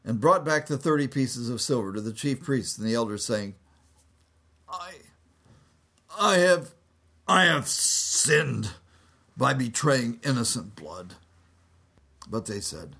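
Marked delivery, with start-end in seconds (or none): 4.7-11.2 high, broken confession, slow on "I have sinned"; remorseful Judas. Acted. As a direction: stammer the guilt out. Judas repents.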